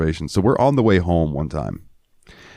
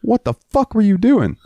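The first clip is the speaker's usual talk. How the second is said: mock yell. Wes confronting the swerving driver, high mock yell. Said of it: "and Wes is like".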